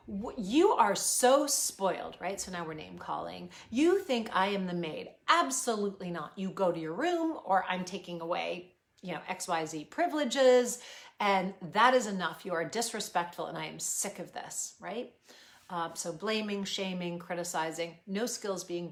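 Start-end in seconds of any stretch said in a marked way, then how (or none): 0.1-2.1 put-on loud, slow scolding of a spoiled child; harsh scolding. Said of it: "come down really hard on that kid". 3.7-14.7 loud, angry authoritarian telling-off; harsh scolding. Acted. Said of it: "come down really hard on that kid".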